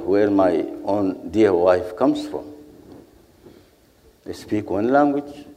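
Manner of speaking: ordinary talk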